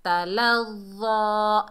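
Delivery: तलज़ा with a full, soft ज़ा; heavy soft za. Shown as a demonstration. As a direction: recite slowly, ज़ा heavy yet gentle. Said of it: "मोटा भी पढ़ेंगे और नरम भी पढ़ेंगे".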